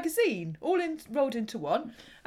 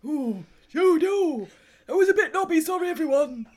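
higher, louder mock northern English accent; northern English impression; 4 semitones higher, 5 dB louder than usual. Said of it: "Why do I sound like a northerner?".

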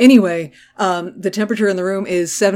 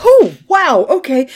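much higher, louder gasped wow; mock surprised; 5 semitones higher, 6 dB louder than usual. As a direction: play it startled and flustered. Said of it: "she's like".

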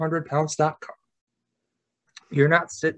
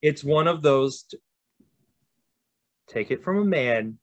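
normal talking voice

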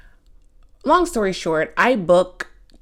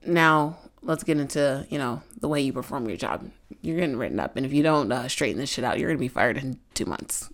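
mild stern boss; 6 semitones lower, 6 dB quieter, faster than usual. Retold or imitated. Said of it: "and then said".

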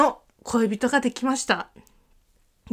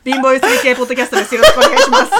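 laughing through the intro; trying not to crack up while reading; stifled giggles; 3 semitones higher, 8 dB louder, faster than usual. Real, not acted. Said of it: "喋んな、笑うな。".

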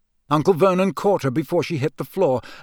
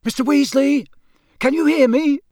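high, projected call; calling through boards; 12 semitones higher, 5 dB louder than usual. A narrator's reading. Boy to man inside blocked fireplace, calling. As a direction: call out to someone behind a wall. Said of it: "called through the boards".

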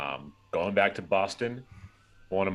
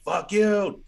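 put-on a high, loud Boston-accented "Fuck you", a touch lighter; Boston accent scream; 13 semitones higher, 5 dB louder than usual. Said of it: "And he just does, like, one more".

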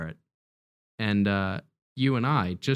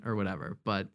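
usual speaking voice

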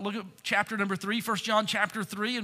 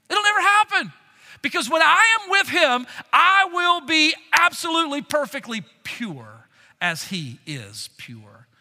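higher and louder, soaring on the promise; full-force preaching; 5 semitones higher, 6 dB louder than usual. Acted. Pastor announcing to the church that one day he will be pure, full-force preaching. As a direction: proclaim it with joy, building. Preaching about coming perfection.

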